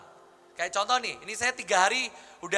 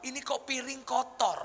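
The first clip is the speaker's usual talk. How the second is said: higher-pitched put-on grumble; mock husband complaint; 4 semitones higher than usual. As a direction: imitate a tired husband finding fault. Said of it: "Suami pengen nunjukin nih".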